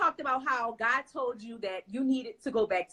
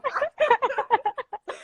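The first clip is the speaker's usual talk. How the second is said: 1 high laugh; 12 semitones higher than usual. Real, not acted. Host laughs.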